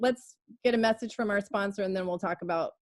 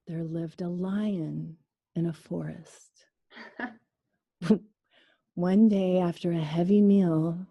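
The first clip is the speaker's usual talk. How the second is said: sleep story voice; 5 semitones lower, slower than usual. Shown as a demonstration. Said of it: "the way that they do it is".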